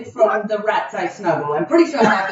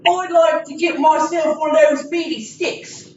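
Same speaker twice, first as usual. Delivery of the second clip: higher, shouted put-on British accent; hammed-up British peasant ordering meat; mock-Cockney customer; 8 semitones higher than usual. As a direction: yell the order over the crowd. Improvised Renaissance fair scene.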